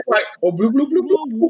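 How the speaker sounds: high bubbly fish noises; underwater blub blub. Pretend. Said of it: "gonna do a fish person impression there".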